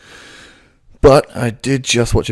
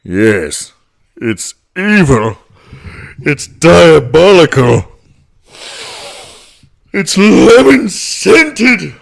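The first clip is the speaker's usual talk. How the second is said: louder, slow villain voice rising higher; Plankton voice; 7 semitones higher, 8 dB louder, much slower than usual. Shown as a demonstration. Plankton as a superhero, slow loud villain voice. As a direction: make it diabolical, slow and building. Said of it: "If I was going to do a superhero voice".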